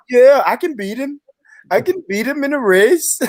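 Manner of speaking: high-pitched mocking quote; Ezra. Retelling a friend's boast about racing an Olympian, high mocking voice. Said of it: "And Ezra said".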